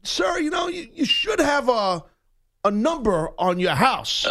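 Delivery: a touch of the annoyed stranger calling out; mock annoyed shout. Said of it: "He goes".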